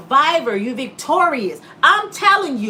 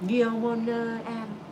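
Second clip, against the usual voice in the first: Eve tempting; 3 semitones lower, 8 dB quieter than usual. Retold or imitated.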